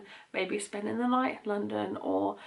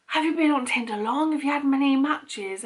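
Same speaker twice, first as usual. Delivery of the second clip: raised, loud questions; retold shouting; 4 semitones higher, 4 dB louder than usual. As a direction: call it out loud across the room.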